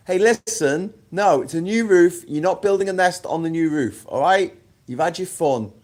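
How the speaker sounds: high, loud scolding of a wasp; telling off a wasp. Real, not acted. To a wasp building a nest on the new roof, a high loud scolding.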